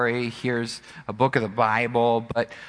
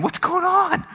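high-pitched alarmed cry; acted bewilderment on being shaken awake; startled sleeper; 3 dB louder than usual. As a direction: voice leaping up in confusion. Sleeper woken in the storm scene.

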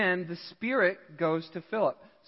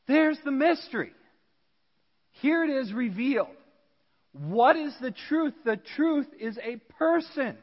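full-force preaching; 7 semitones higher, 4 dB louder than usual. Acted. Preaching climax, raised voice.

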